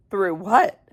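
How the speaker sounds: plain reading voice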